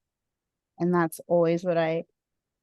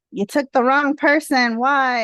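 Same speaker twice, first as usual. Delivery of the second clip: raised, higher, louder cry of blame; retold anger at God; 6 semitones higher, 7 dB louder than usual. Daughter crying out at God over her dad, angry. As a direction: hurl the accusation up at God.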